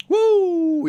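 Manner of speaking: loud, very high "woo"; exuberant whoop. Real, not acted. Speaker celebrating being thankful to be alive, exuberant whoop.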